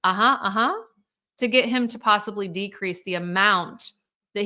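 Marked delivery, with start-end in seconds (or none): 0.0-0.7 mock going-along agreement, rising at the end; just go along with it, noncommittally; imitated compliance. Children humoring their father, mock compliant agreement. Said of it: "comply and say".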